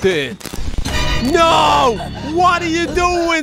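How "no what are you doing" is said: high, loud hammed-up shouting. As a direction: yell in mock outrage. Pajamas being ruined in game.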